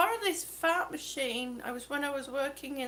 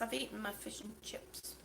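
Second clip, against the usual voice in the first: unvoiced whispered aside; 6 semitones lower, 16 dB quieter than usual. Real, not acted. Whispering an aside to the chat. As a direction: drop to a breathless whisper. Aside while eating on stream.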